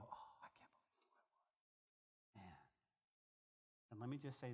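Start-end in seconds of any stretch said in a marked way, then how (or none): 0.0-2.6 slow, hushed whisper of regret; ashamed whisper. Retold or imitated. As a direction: breathe it out in shame. Recalling shame after salvation.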